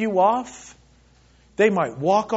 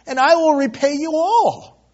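begging; 8 semitones higher, 5 dB louder than usual. Acted. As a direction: beg for patience, rising in pitch and volume. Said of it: "begged him".

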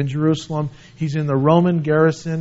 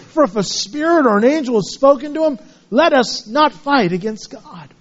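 raised proclaiming read; scripture reading; 5 semitones higher than usual. Acted. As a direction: declaim the Pharisees' defence. Reads scripture aloud.

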